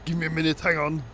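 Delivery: a hint of put-on Arnold; lightly voiced Arnold. Arnold puts himself on hold, lightly put-on voice. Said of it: "he just replies back".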